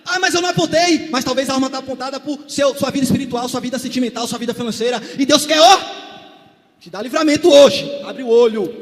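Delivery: loud preaching call. Acted. Preacher urging the congregation to open their eyes. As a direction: urge them to wake up.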